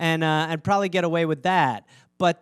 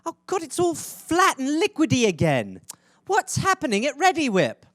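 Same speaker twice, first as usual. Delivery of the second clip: higher, exasperated mom voice; impression of my mother; 8 semitones higher than usual. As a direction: whine like a fed-up mother at the fridge. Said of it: "This is my impression of my mother".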